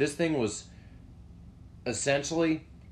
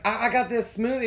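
high-pitched put-on boy voice; son answering Mom; 12 semitones higher, 3 dB louder than usual. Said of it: "he literally responds back to Mom".

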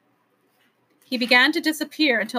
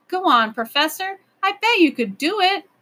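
higher-pitched, eager cheering-on; encouraging; 8 semitones higher, 4 dB louder than usual. A narrator's reading. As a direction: urge someone on with confidence. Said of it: "encouraged Ben".

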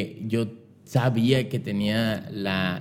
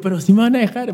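high, loud worried question; anxious hope; 12 semitones higher, 6 dB louder than usual. Retold or imitated. Son asks mom if they'll let him in, high and nervous. His anxious retold reply.

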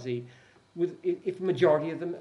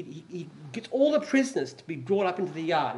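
usual speaking voice

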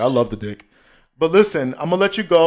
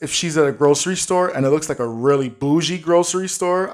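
usual speaking voice

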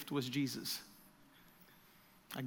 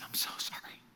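whispered, much softer apology; tearful whisper; 9 dB quieter than usual. Retold or imitated. Graham to Jesus in his tears, whispered. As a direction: breathe the apology through tears. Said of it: "in his tears".

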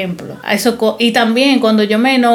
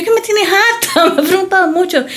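fed up; 8 semitones higher than usual. Retold or imitated. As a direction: drag it out, utterly fed up. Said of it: "Y yo".

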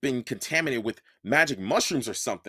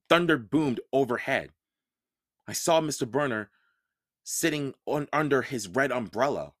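plain reading voice

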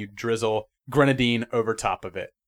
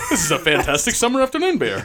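higher, laughing beer-snob impression; imitating a beer snob; 5 semitones higher than usual. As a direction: gush like a beer reviewer. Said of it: "the same way Jay describes every beer".

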